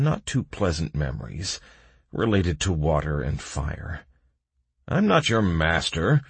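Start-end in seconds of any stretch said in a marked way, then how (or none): 4.9-6.2 mildly raised, firm retort; a restrained shout, pitched up; guard's shout. A narrator's reading. Guard correcting the bog creature, shouting mildly. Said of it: "shouted the guard".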